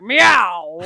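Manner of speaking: loud mock cat yowl; imitating a cat. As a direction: meow loudly like a cat in the hallway. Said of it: "he's just there, like".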